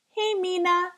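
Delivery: put-on a kid getting "Mina" wrong, as Mina; name mispronounced. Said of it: "couldn't say it right".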